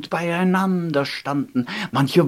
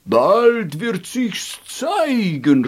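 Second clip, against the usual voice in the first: slow, louder chorus call; chorus proclaiming; 3 semitones higher, 4 dB louder, slower than usual. Acted. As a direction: ring it out slowly like a choir. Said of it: "rief der ganze Chor".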